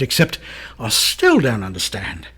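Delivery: ordinary talk, nothing marked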